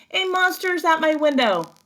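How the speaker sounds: high, loud terrified shout; frightened shout. Acted. Baby seeing a snout at the window, a high loud scared shout. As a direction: scream out in fright.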